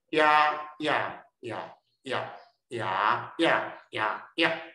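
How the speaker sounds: slow run of 呀 through the tones; deliberate tone-by-tone recital of 呀; tone drill. Shown as a demonstration. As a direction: chant 呀 slowly, each one on a different tone. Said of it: "老师搁念一下哦".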